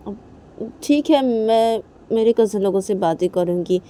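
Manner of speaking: high, taunting villain voice; mocking mother-in-law. Reshma mocks the cousins' story, high and taunting. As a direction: sneer at every word.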